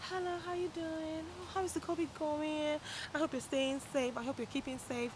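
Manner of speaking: soft, high-pitched polite greeting; friendly small talk. Shown as a demonstration. Greeting someone sitting next to you, gentle friendly small talk. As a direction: check in sweetly, light and high. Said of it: "Let's assume there's someone sitting right here".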